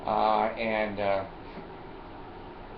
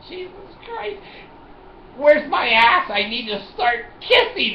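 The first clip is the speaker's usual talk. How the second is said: exasperated aside; 12 semitones higher, 3 dB louder than usual. Retold or imitated. Exasperated inner reaction.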